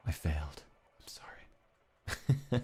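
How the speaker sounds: slow guilty whisper; whispered apology. Real, not acted. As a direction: whisper the confession sheepishly. Confessing failing the memory test.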